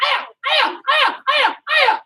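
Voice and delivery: high, loud, repeated kiai; martial arts shout. Shown as a demonstration. Coach demonstrates inside blocks to class, sharp loud shouts. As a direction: punch out each cry with a block.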